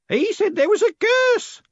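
very high, loud, relishing the gossip; pleased woman. A narrator's reading. Woman telling Moist about the curse, rather pleased. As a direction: draw it out with delight. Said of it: "as if the idea was rather pleasing".

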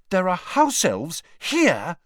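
high, slow, horrified gasp of words; appalled at what she just heard; horror-struck. Acted. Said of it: "staring, horror-struck".

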